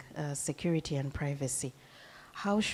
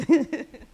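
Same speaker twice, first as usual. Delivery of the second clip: a quick, loud, high laugh; short laugh; 12 semitones higher, 14 dB louder than usual. Real, not acted. Host laughing as she hands over to Mister Evans. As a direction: snap out a laugh as you hand over.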